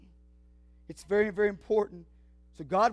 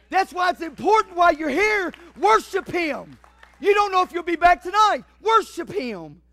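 loud, high preacher's cry, slowing on worship him; full-force preaching; 5 semitones higher, 7 dB louder than usual. Acted. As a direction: build to a shout and hammer it home.

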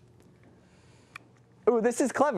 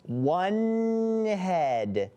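long, stretched words; slow, tentative guessing; drawn-out guess; 2 semitones higher than usual. Pretend. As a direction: stretch each word while puzzling it out. Guessing a whispered phrase.

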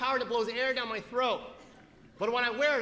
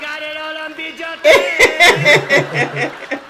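mom voice; 6 semitones higher, 17 dB louder, much slower than usual. Retold or imitated. Mom voice in retold story.

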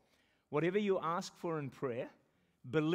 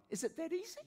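high, softer question to God; wondering question; 12 semitones higher, 4 dB quieter than usual. Retold or imitated. Pastor retelling his question to God, high and soft. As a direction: ask it like a surprised child. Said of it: "I'm saying to God".

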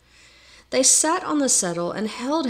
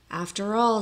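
ordinary delivery, nothing marked

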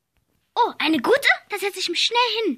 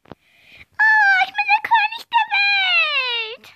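triumphant boast; 11 semitones higher, slower than usual. Acted. Toy boy boasting to classmates, high gleeful shout. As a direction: crow it out like king of the world.

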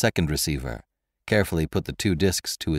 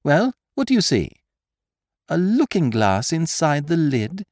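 lightly raised, mocking gentleman thief; Lupin's voice; 11 semitones higher, 4 dB louder than usual. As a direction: needle the prisoner with sly amusement. Lupin urging Marco.

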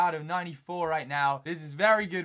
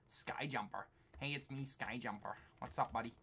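hushed, much softer creeping voice; sneaky whisper; 5 semitones lower, 12 dB quieter than usual. Pretend. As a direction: murmur like you're sneaking up behind someone. Sneaking up on enemy player.

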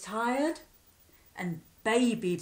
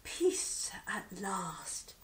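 soft, slow and relieved; to himself; 2 semitones lower, 7 dB quieter, much slower than usual. Acted. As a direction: murmur it with relief. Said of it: "he said to himself".

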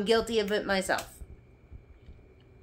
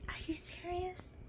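breathy whispered disbelief; whispered are you serious; disbelieving whisper; 9 semitones higher, 17 dB quieter than usual. Real, not acted. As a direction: whisper it in shock.

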